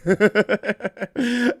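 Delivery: high chuckling laugh; amused laughter. Real, not acted. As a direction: giggle at your own impression. Laughs at own gangster voice.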